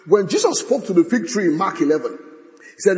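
normal talking voice

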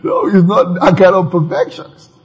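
ordinary talk

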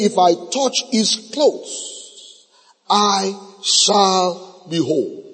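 very slow, measured quote; drawn-out, deliberate recitation; slow quoted declaration. Acted. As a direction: voice her words of faith slowly, word by word. Quoting the woman's repeated confession.

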